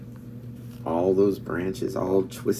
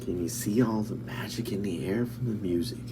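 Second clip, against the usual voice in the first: ordinary delivery, nothing marked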